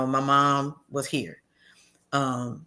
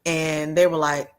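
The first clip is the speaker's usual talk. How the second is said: ordinary delivery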